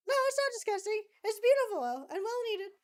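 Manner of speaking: high, loud mock protest; indignant. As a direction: squeal it like it's sacred. Playful protest about Cultivate.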